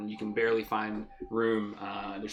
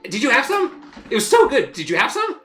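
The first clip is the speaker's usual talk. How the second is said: high, loud gushing squirrel; excitable squirrel host; 13 dB louder than usual. Acted. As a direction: gush about a treat in a high squirrel voice. Squirrel host delighted about wine.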